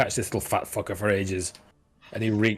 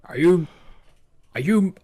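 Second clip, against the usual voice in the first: higher, louder, indignant guard; offended guard voice; 9 semitones higher, 6 dB louder than usual. Acted. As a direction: bristle at being mocked.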